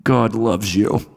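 a slow, gentle, lightly voiced loving message; mock tender prophet. Preacher imagining Jonah telling Nineveh God loves them, gently. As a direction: soften it as if promising blessings. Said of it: "he's gonna go".

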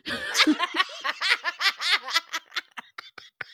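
long, high burst of laughter; laughing. Real, not acted. Teller cracking up at the podcast insult, laughing. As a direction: crack up for several seconds.

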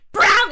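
loud imitated crash; crash sound. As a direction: bang it out like a fall. Sound of falling to ground.

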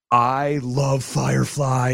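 retold fan gushing. Fan meeting the actor from Firefly, gushing. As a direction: act out a starstruck nerd drawing out every word.

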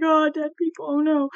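hammed-up dismay; super emotional. Imagining herself overwhelmed in medicine, mock emotional. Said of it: "super emotional about it".

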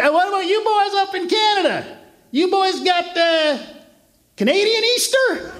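high-pitched Southern twang, curious; Southern barfly voice. Retold or imitated. Southern local asking about Canada, high twang. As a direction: play the bar guy, leaning into the accent. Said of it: "And then he says".

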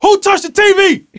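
high, loud furious yelling with a laugh under it. Retold or imitated. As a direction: bark at the room like a pit bull. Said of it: "I was like a pit bull".

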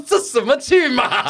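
high, very slow disbelieving drawl; high loud slow what-is-this-soap-opera; incredulous thought. Retold or imitated. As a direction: stretch it out in disbelief at the drama. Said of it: "我心里想说".

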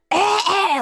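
high, loud hacking "eh, eh"; loud throat-clearing noise. Retold or imitated. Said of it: "like, so loud".

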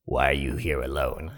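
very low, soft come-on; imitating a flirty stranger in a deep voice; seductive woman. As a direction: ask it low and suggestive. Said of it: "just that kind of voice".

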